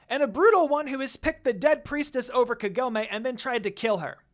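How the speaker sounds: high, loud angry servant's yell; a little retainer screeching at the girl. A narrator's reading. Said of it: "who argued back".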